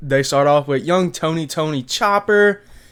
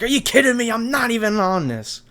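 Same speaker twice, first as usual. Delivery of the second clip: indignant; 8 semitones higher than usual. Retold or imitated. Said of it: "He's like".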